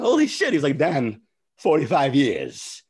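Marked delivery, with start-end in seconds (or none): 0.0-0.5 high, loud holy shit; stunned exclamation; amazed. Retold or imitated. Amazed reaction retold. 0.8-2.8 slow, deliberate Walken cadence; lightly imitated Christopher Walken; Walken impression.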